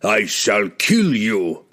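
very slow, measured, forced calm. Acted. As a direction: keep it calm despite the threat. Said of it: "with as much calm as he could muster".

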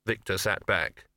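usual speaking voice

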